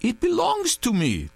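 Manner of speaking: high, pleading insistence; insistent. A narrator's reading. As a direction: insist he owns it, rattled. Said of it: "Touré insisted".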